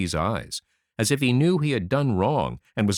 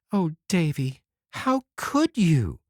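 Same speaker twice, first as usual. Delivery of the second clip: slow and higher, near tears; quivering voice; 7 semitones higher than usual. A narrator's reading. Anne near tears asking Davy why he lied, quivering. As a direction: hold back tears as you ask. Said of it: "with a quiver in her voice".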